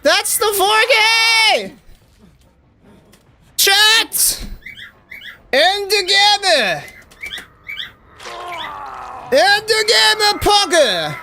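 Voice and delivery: high, slow, stretched hype calls; hyping a four kill win to chat, high and stretched; hyped shout-out. Real, not acted. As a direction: sell it to the chat like a victory.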